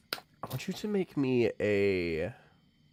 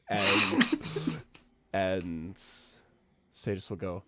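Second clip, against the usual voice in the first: usual speaking voice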